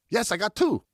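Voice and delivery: put-on high, different boyish voice; pleased with himself; lightly voiced little boy; proud younger boy.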